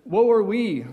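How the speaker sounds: woe lament. Retold or imitated. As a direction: declaim "Woe are we" like a grand lament. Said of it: "here's one that I think I hear".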